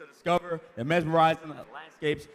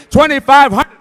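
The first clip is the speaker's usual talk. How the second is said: Twenty-five hundred called out much higher and louder; loud bid call; 10 semitones higher, 15 dB louder than usual. Shown as a demonstration. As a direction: belt the new bid to the room. Auctioneer repeats bid amount.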